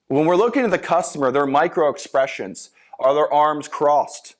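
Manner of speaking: usual speaking voice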